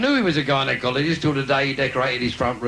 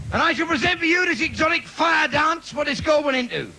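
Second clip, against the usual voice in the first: raised, pompous compere voice announcing the act; mock showman announcement; 13 semitones higher than usual. Comic stripper announces his fire dance to the audience, mock grand. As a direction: puff it up like a variety-hall compere.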